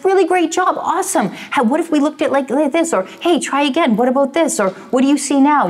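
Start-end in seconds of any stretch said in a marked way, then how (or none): 0.0-2.9 put-on much higher, praising voice for a five-year-old; encouraging a child. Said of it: "we would be encouraging". 3.2-4.6 put-on much higher, praising voice for a five-year-old; encouraging a child. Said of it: "we would be encouraging". 4.9-5.7 put-on much higher, praising voice for a five-year-old; encouraging a child. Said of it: "we would be encouraging".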